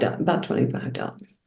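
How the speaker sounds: breathy whisper of the price; whispered aside. Real, not acted. Repeating the bill to a friend, whispered. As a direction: drop to a whisper for the total.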